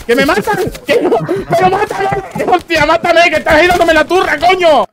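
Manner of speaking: high frantic shouting; panicked yelling. Real, not acted. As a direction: yell it like you're being killed. Panicking as he's killed in game.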